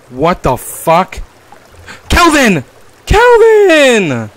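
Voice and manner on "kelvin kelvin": high, loud shout across distance; calling out. Real, not acted. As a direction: yell for a lost friend. Calling out for the companion.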